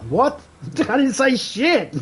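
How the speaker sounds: very high squeaky protest; indignant disbelief. Retold or imitated. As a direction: squeal it in outrage. Acted indignant reply in skit.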